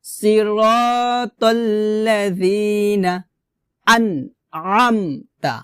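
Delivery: pressed throat ayn in drawn-out "আনআমতা"; tajweed recitation. Shown as a demonstration. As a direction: recite "সিরাতাল্লাযিনা আনআমতা" slowly, holding long vowels. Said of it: "বলতে হবে".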